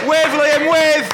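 loud shout to wave; calling out. Real, not acted. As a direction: call it out across the room. Calling out to Liam.

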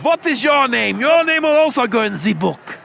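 lightly done stern German officer; a hint of the Dad's Army U-boat captain. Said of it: "that's my go-to impression, Dad's Army".

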